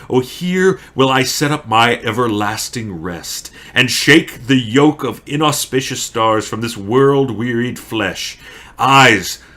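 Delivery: impassioned Shakespearean declamation; Romeo. Acted. Romeo vows to stay with dead Juliet, impassioned. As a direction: build to ringing vows, then slow farewells.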